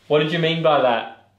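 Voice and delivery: ordinary talk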